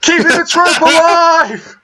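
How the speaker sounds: high, slow mock shout. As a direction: yell it out, drawn out. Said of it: "the one that was shouting".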